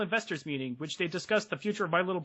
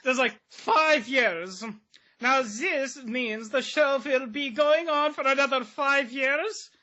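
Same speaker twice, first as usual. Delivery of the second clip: lightly put-on German accent, slow; 6 semitones higher, slower than usual. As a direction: read it like a German announcer. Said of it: "read it in a German accent".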